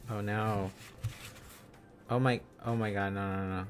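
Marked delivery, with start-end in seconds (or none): none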